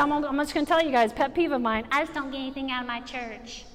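lightly voiced grumbling member; mild mock complaint, dropped low; mock churchgoer complaint. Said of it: "I said".

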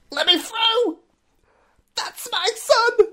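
very high, slow, wailing cry of a grieving dad; anguished father impression. Podcaster's Guess the Impression bit, a grieving dad's very high wail. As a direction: howl it like a father over his son's body. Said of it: "guess this from a famous film".

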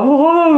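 high imitated wail from a skier; mock cry while whizzing away; long high whoop; drawn-out "oooh". Child skiing alone, crying out.

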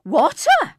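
high, very loud holler; excited shout. A narrator's reading. Trippers spotting the river, excited shout. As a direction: shout it out to everyone. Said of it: "they shouted".

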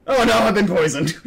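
loud, high, hammed-up dying wail; mock poisoned cry. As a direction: overact being poisoned, laughing.

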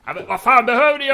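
fast, high, loud retort; mock yell. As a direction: shout it back fast. GM answers in yelled voice.

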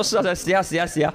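lightly voiced Cantonese father; a hint of Cantonese-accented Mandarin. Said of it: "他说".

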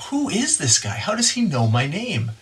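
high, astonished question; a tax collector stunned that Jesus knows his name; amazed disbelief. Retold or imitated. Preacher voicing an astonished Zacchaeus, high and amazed.

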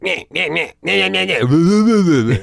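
high, mocking nonsense squabble; imitated bickering. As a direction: mimic parents yammering at each other, drawn out. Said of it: "by them fucking bickering in the kitchen".

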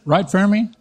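ordinary talk

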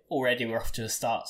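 ordinary talk

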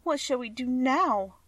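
put-on high, slow voice of a sad girl. A narrator's reading. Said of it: "asked Dorothy sadly".

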